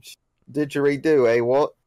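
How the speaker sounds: a light put-on Australian accent with stumbling stock phrases; hammed-up Australian dingo talk, lightly done; mock Australian accent. Mimicking the cartoon dingoes in a mild mock Australian accent. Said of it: "And like".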